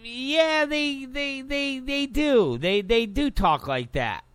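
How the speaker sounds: mock woman's voice, halting repeats; imitating a woman. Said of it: "Kristen Cavalier ... says".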